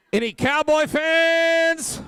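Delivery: high, slow, drawn-out call to the room; calling out to the crowd. Real, not acted. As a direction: stretch it out to rouse the fans.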